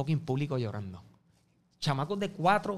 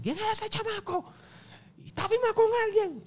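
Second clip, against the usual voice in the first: much higher put-on voice for Nelson; imitating Nelson. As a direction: mimic the producer's eager questions. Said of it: "y Nelson". (imitando a Nelson con voz mucho más aguda)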